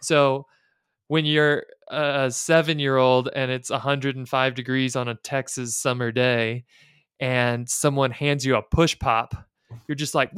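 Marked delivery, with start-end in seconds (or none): none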